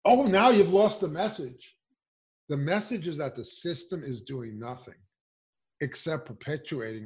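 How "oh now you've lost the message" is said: high, loud sarcastic mimicry; mocking media voice. Mocking the media about armed protesters, high and loud sarcasm. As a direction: sneer out the media's excuse. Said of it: "Are you saying".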